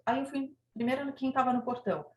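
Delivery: usual speaking voice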